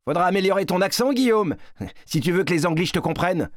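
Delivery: high, fast, scornful jeer; rapid, high-pitched teasing with disdain; Yvon mocking. A narrator's reading. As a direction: taunt a younger boy and send him packing. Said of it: "Yvon ne rate jamais une occasion de se moquer".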